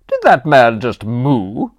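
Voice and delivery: loud, slow disbelieving question; shocked aunt. A narrator's reading. Aunt stunned by the mooing butler, loud and slow. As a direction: ask it stunned. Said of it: "looked like she'd just been punched".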